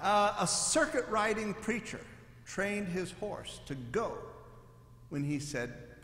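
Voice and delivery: normal talking voice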